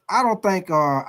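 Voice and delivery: ordinary delivery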